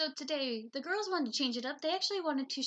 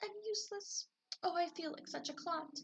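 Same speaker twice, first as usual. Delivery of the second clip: sad; 8 semitones higher, 4 dB quieter than usual. Acted. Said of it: "he thought".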